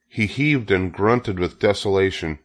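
ordinary delivery

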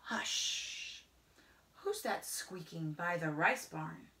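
ordinary delivery, nothing marked

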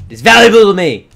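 sudden high, loud yell; defensive outburst. Pretend. Gamer defending his valuables, sudden defensive yell.

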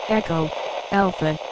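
high-pitched, processed radio voice spelling code words; intercepted code transmission. Acted. Decoded code from the submarines, high processed radio voice. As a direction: call out each code word like an intercepted signal.

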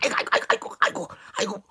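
breathy whispered 아이고 on every repeat; 패대기 당한 하인의 속삭이는 신음; whispered groan. Acted. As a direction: wheeze out 아이고 after being thrown. Servant groans when thrown.

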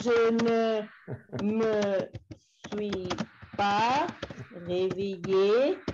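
each word separated by a pause, very slow; teacher slowly models the negative for a student; slow word-by-word demo. Shown as a demonstration. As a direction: lay out je ne me suis pas réveillé one word at a time. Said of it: "Cuesta un poco, pero está bien".

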